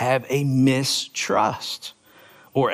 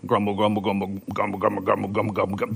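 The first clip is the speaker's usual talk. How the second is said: low, soft mumbled grumble, grumble; low mumbling imitation of grumbling; grumbling murmur sound; 5 semitones lower, 5 dB quieter, much faster than usual. Shown as a demonstration. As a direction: mutter the grumbles under your breath. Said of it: "That's very much the sounding out of the Greek".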